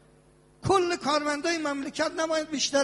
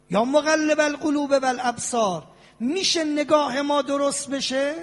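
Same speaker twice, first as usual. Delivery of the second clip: prayerful supplication; 3 semitones higher, 4 dB louder than usual. Acted.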